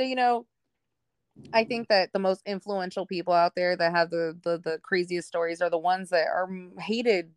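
usual speaking voice

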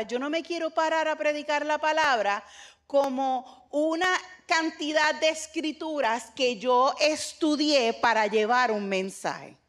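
normal talking voice

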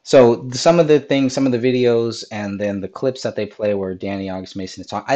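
ordinary talk

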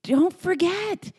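put-on high child voice, dropping to an earnest whisper; little boy reminding everyone. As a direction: play a tiny preacher calling everyone to stop. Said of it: "he says".

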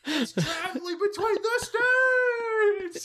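high, loud laughing shout; excited shout. Pretend. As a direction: cry it out, laughing and slow. Joking outburst about sandwich.

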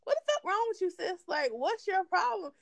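high-pitched imitated snap; mock exasperated, pitched well up; Chloe. Retelling sister snapping at business meeting, mock fed-up. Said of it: "Chloe just finally snapped and was like".

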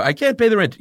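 hammy wailing damsel in distress; silent film melodrama. Acted. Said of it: "a lady about paying the rent".

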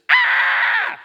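sudden very high, loud scream; piercing imitation of a startled scream; imitated scream. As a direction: shriek out in shock, mimicking his cry. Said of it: "And then I hear this".

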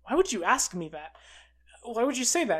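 mildly offended retort, pitch lifting; insulted. Retold or imitated. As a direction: act out someone taking mild offence at a question. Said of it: "she kind of insultedly goes".

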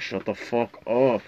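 low, slow imitated irritation; retold pissed-off mutter. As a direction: grumble it slowly under your breath, fed up. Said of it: "He's getting so pissed off".

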